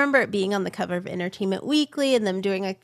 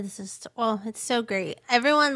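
ordinary talk

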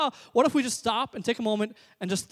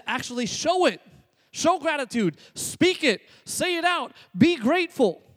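higher, building to loud punchy commands; emphatic exhortation; 8 semitones higher, 5 dB louder than usual. Acted. Preacher urging the congregation to show gratitude, emphatic exhortation. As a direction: drive each short command home, rising in force.

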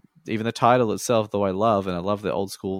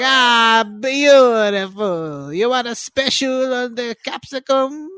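put-on much higher, loud Greek-accented fruiterer, beautiful drawn out slowly; Con the Fruiterer; 9 dB louder, slower than usual. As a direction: Greek accent, a jolly fruit-shop owner offering a deal. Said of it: "he talk like this".

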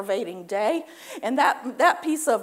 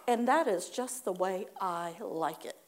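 slow, quieter, lower aside; soft sly tag; 5 semitones lower, 6 dB quieter than usual. Shown as a demonstration. Preacher adding the tag line softly after a curse. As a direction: drop to a knowing murmur. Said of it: "you also tag this on".

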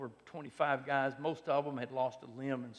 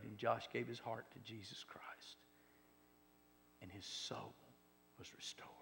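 soft, fading into a whisper; hushed whisper; 3 semitones lower, 12 dB quieter than usual. Acted. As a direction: let it fall to a reverent whisper. Hushed close of the story.

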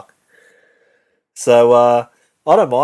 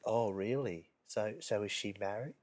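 hushed gossip imitation; 15 dB quieter than usual. Mimicking the nosy coworker prying in a soft, hushed voice. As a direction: drop to a soft, secretive murmur. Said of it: "And it's like".

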